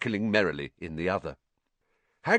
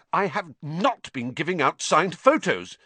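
higher, louder, indignant denial; hotly; 9 semitones higher, 5 dB louder than usual. A narrator's reading. Harry hotly denying it to Hagrid, higher and louder. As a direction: bristle at the accusation. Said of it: "he said hotly".

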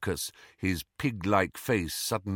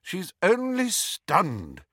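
high, slow, exasperated correction; brisk-tempered teacher, words drawn out; impatient; 9 semitones higher, much slower than usual. A narrator's reading. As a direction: correct them with strained patience. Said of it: "said Professor McGonagall impatiently".

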